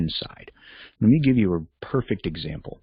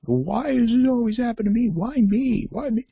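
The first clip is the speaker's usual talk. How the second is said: very high-pitched whiny imitation; exaggerated self-pitying whine; mock whining. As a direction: wail it like a hammed-up victim. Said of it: "I know people like that".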